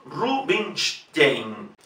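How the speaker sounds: Rubinstein very slow, S after N said sh; Rubinstein, slow and soft, -shtein; slow sh demo. Shown as a demonstration. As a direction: drag out Rubinstein with sh. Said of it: "se pronuncia sh".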